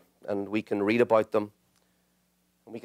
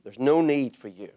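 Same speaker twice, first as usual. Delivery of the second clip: normal talking voice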